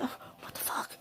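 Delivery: breathy whisper to herself; startled whispered curse; whispered aside. Real, not acted. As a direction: whisper it under your breath. Aside while reading the cards.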